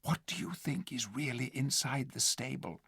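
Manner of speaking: breathless whisper. Acted. Jill whispering to Tyrion, whisper. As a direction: whisper in his ear. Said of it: "trying to whisper in his ear".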